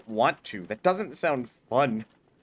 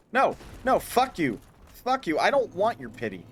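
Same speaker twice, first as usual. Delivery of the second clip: higher, snapping back at the game; indignant retort; 4 semitones higher than usual. Real, not acted. Player retorting to the game's mockery, higher indignant retort. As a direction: tell the game off.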